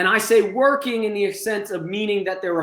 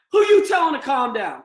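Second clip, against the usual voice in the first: higher shouted challenge; shouting back; 5 semitones higher than usual. Shown as a demonstration. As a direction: yell at the staff member. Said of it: "they continue this escalation".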